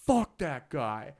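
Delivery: loud, drawn-out mock yell; retold yell. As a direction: imitate an angry bartender yelling, slowly. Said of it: "I hear him yelling".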